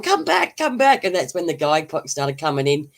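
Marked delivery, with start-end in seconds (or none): 0.0-1.0 high pleading call of come back; mock pleading call, much higher; calling after him. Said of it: "Noni's going".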